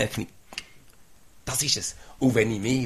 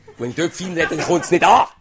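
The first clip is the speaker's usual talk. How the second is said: laughing through the words, louder and higher; 7 semitones higher, 13 dB louder than usual. Real, not acted. Preacher laughing with the congregation about speaking gently, laughing. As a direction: let the laugh carry the line.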